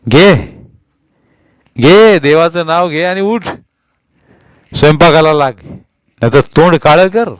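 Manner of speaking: bully her to get up and cook; angry command. A narrator's reading. Husband ordering his wife to cook, loud harsh. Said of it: "तो म्हणाला".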